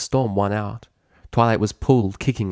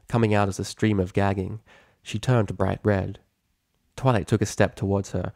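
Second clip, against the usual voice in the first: ordinary delivery, nothing marked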